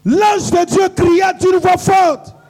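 loud, high-pitched rallying shout; full-voiced, high declamation; shouted preacher's call. Real, not acted. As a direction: shout the verse to set off the crowd. Preacher shouts cue to congregation.

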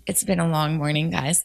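normal talking voice

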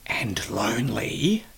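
soft unvoiced whisper, slow. Shown as a demonstration. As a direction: breathe the word lonely slowly. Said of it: "I say lonely whispering".

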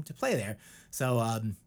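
ordinary talk

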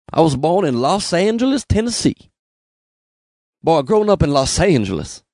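put-on strong southern accent. Comic playing a born-in-Tennessee citizen, strong southern accent. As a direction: lay the southern accent on thick. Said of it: "in a strong southern accent".

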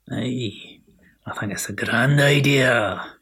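put-on faltering Scottish accent breaking into a laugh. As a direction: try the Scots accent and lose it. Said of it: "I cannot keep this accent up".

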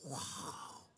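breathy whispered amazement, as retold; awed whisper. Hannah hearing the priest's word, awed whisper. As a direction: whisper it in hushed wonder.

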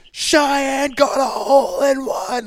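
high, drawn-out mock scream; imitated distant yelling. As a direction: imitate a crowd hollering news across the course. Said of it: "you could just hear them yelling".

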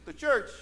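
CHURCH pitched high and emphatic; stress on CHURCH. Shown as a demonstration. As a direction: lift and punch the word CHURCH. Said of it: "That was the big emphasis".

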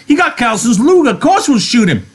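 very high, loud furious insistence; angry Curly. Acted. Curly insists they will shoot Lenny, furious. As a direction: insist with rage.

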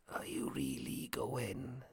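put-on slow, whispered old man; whispering. A narrator's reading. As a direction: whisper a doubtful question. Man with shade asks.

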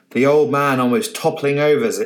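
ordinary delivery, nothing marked

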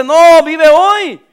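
slow, loud, high; drawn-out emphatic command. Acted. Preacher to worried congregation, slow loud command. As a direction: stretch each word like a command from above.

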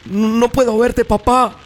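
put-on high, slow wail of a scared boy; crying child. A narrator's reading. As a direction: cry out to dad, frightened and stalling. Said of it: "lloraba el niño". (niño que llora asustado)

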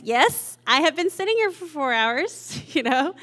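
indignant comeback, pitch climbing high; mock defensive. Couch viewer answering Netflix's prompt, mock defensive. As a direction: playfully protest being judged, voice rising.

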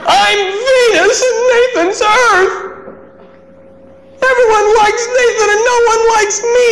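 put-on crying eight-year-old's complaint; sobbing child. Son sobbing to dad at bedtime, high tearful child voice. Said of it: "he says".

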